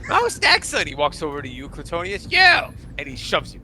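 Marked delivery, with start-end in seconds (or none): 0.1-0.9 high, loud excited exclamation; delighted king. Acted. Kobold king to the party, gleeful. 2.3-2.7 high loud barked "You!"; tiny king shove cry. Acted. Kobold king shoving Cletonius, loud bark.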